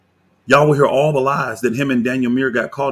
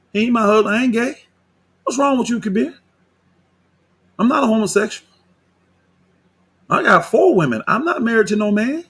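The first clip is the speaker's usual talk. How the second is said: higher, offended protest; indignant outrage; 8 semitones higher than usual. Real, not acted. Answering Kabir's husband comparison, high indignant protest. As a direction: protest sharply in disbelief.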